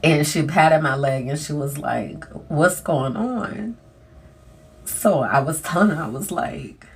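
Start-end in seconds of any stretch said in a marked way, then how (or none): 2.5-3.8 gentle concern. Retold or imitated. Doctor gently asking.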